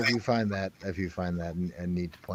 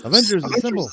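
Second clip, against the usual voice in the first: movie catchphrase shout; 9 semitones higher, 7 dB louder than usual. Pretend.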